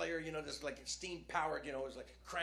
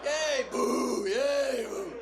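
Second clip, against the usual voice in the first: higher, louder mock crowd; crowd cheer and boo; 7 semitones higher, 9 dB louder, much slower than usual. Comic imitating the crowd cheering beer and booing jokes, loud.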